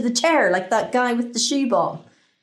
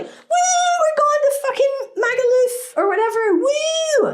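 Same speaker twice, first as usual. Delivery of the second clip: very high party whooping; rowdy holidaymakers; 4 dB louder than usual. Acted. People off to Magaluf, whooping excitedly. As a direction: whoop like a tipsy holiday crowd.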